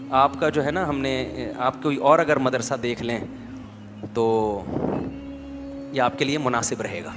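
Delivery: soft, measured, polite voice; quiet dignified politeness; calm, unhurried teacher. Retold or imitated. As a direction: speak gently and without hurry, like a patient elder. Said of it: "بڑے آرام آرام سے".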